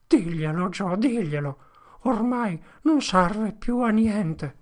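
resigned pleading, slowing at the end; defeated plea. Acted. Potter telling Joe to speak, defeated and slow. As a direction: give up, weary.

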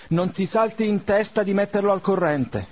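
urgent order. A narrator's reading. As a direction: press the servant hard.

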